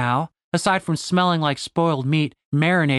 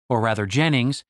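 ordinary delivery

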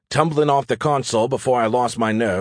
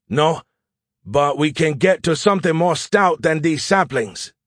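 higher, firm commanding voice; Zhao; 6 semitones higher than usual. Acted. Officer to soldiers behind saplings, firm and urgent. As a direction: overrule them with an officer's urgency.

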